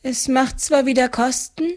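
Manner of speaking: high woman's voice, mildly relieved; thankful with a touch of relief. A narrator's reading. Debtor thanking the farmer, mildly relieved. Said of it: "Die Frau atmete erleichtert auf".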